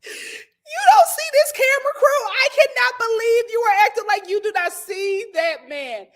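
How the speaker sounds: high, loud and incredulous; loud, high disbelieving plea to Tiffany on TV; exasperated call-out. Retold or imitated. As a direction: throw it at her in disbelief.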